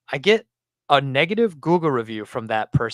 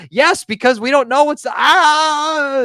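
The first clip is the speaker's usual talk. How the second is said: high, loud frustrated reply; louder, near a groan of frustration; exasperated; 12 semitones higher, 7 dB louder, much slower than usual. Retold or imitated. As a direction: shop owner losing patience with customer. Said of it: "quoted exchange with customer".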